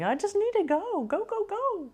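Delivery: high, rushed go-go-go voice; restless impatient person. Retold or imitated. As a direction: squeak it out like someone who can't sit still. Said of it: "They're like".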